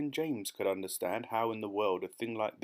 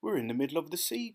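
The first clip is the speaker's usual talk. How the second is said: higher, hurried exclamation; alarmed cry; 6 semitones higher, much faster than usual. A narrator's reading. James discovering they are at sea, crying out. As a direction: blurt out the discovery fast. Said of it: "cried James".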